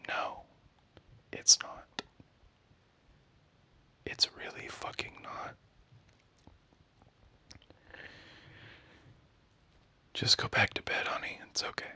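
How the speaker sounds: soft whisper, trying to calm; gentle reassuring whisper; hushed whisper. Acted. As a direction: whisper it to send them back to sleep.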